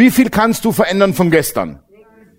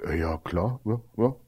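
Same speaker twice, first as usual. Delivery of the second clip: much softer, lower, baffled mumble; bewildered host; 6 semitones lower, 12 dB quieter than usual. Retold or imitated. Host answering an odd guest, baffled and soft. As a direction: mumble a puzzled yes.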